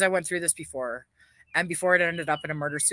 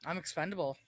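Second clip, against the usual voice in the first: much softer, sighing and spent; defeated sigh; 11 dB quieter than usual. Real, not acted. Alone on her live stream after the rant, sighing in defeat. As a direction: let it fall out, worn out and hopeless.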